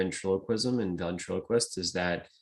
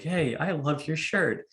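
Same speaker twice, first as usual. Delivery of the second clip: sweet puppet voice; 7 semitones higher, slower than usual. Retold or imitated.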